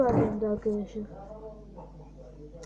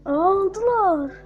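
higher and louder in disbelief; surprised cry; 6 semitones higher, 5 dB louder than usual. Real, not acted. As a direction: blurt it out, caught off guard. The attackers win after all. (şaşkınlıkla yüksek sesle)

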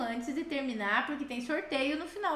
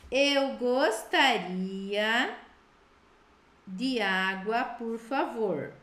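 slow dictation; 2 semitones lower than usual. Shown as a demonstration. Teacher dictating to students, slow and clear. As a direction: read it slowly so they can write. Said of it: "A teacher vai falar em português".

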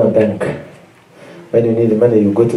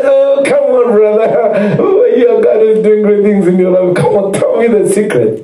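mock flatterer; 5 semitones higher than usual. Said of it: "People will come when they hear".

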